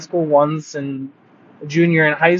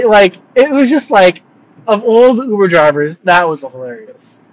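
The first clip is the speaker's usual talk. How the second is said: usual speaking voice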